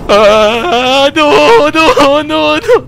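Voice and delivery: high, loud panicked screams of no; real terrified yelling; terrified screaming. Real, not acted. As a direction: shriek in real panic at the scare. Chased and scared in game.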